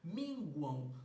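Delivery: teacher showing how Minguam ends in ão. Shown as a demonstration. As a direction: end Minguam on ão, not am. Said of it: "como se fosse ão".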